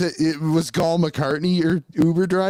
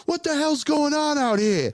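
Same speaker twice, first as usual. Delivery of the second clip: mock yell; 12 semitones higher than usual. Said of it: "yelling out the window".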